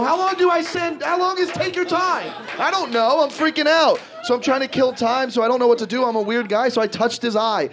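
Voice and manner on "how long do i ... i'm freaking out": high-pitched panicked self-questioning; paranoid freak-out. Acted. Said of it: "I'm in my head all fucking paranoid".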